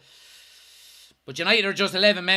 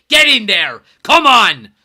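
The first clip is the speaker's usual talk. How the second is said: triumphant shout; 8 dB louder, slower than usual. Real, not acted. Celebrating the win.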